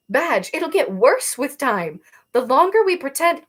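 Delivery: slow, loud, pleading; urgent insistence. Acted. Arrow pressing Badge, slow and loud. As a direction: make her understand.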